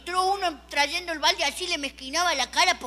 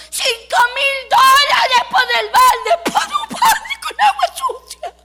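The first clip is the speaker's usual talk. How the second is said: very high, loud, slow shout; shrieking bid; 11 semitones higher, 9 dB louder, slower than usual. Retold or imitated. A lady shrieks her bid, very high and loud. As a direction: screech the bid across the room. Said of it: "Una señora gritó".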